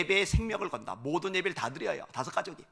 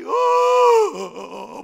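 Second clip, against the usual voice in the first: loud, high wail; a proud man breaking into loud weeping; wailing cry; 12 dB louder than usual. Retold or imitated. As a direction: let out one sudden wail. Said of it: "막 통곡을 해요".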